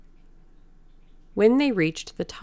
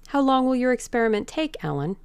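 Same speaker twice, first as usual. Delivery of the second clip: calm, indifferent; 7 semitones higher, faster than usual. A narrator's reading. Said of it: "he said in a calm, indifferent voice".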